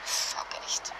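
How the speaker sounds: bitter whispered curse. Acted. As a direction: curse under your breath, barely voiced. Leiser Fluch nach dem Überfall. (geflüsterter Fluch)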